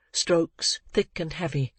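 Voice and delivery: usual speaking voice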